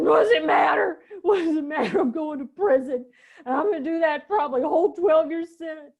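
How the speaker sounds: relived outburst of despair to a visitor; retold despairing outburst. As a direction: throw the words out in hopeless anguish.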